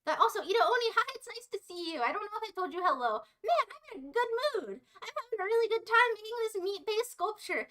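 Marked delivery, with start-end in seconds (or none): none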